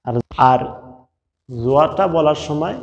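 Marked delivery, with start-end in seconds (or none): none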